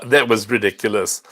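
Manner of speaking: ordinary talk, nothing marked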